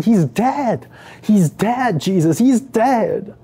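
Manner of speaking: high-pitched, disbelieving protest; distraught insistence. Acted. Mary stares at Jesus after his command, distraught insistence.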